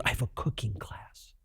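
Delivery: whispered under the breath, no voice at all; hushed aside. Retold or imitated. Director whispering his excuse to the actor, hushed. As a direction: mutter the excuse like a secret.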